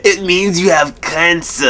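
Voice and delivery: mock diagnosis. Psychology student friend telling him he has cancer, mock solemn. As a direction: deliver it like a mock doctor's verdict. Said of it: "she'll be like".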